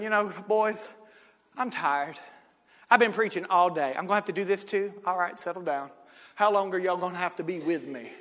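low, soft, weary voice; tired Jesus. Acted. Jesus woken up by his disciples, tired and soft. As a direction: sleepily grumble at the boys.

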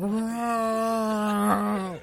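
drawn-out slow-mo movie voice; long, loud slowed-down cry; slow-motion shout. Acted. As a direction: stretch the word out in mock slow motion. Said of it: "everything slow-mo".

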